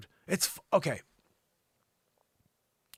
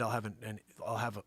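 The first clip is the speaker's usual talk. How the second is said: ordinary talk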